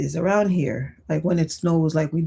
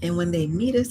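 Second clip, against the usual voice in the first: ordinary talk, nothing marked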